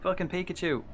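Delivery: high excited yell; excited shout. Real, not acted. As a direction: yell it out in delight. Spots Pikachu again.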